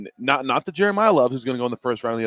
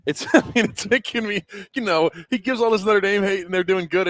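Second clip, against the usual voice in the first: bursts out laughing, then talks on much higher and louder; 9 semitones higher, 4 dB louder than usual. Real, not acted. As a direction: laugh it off in disbelief. Laughing at rival coach's gloating.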